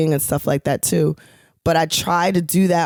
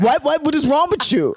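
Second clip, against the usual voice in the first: mad friend; 10 semitones higher than usual. Retold or imitated. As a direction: scold like an outraged friend. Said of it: "my New York accent comes out".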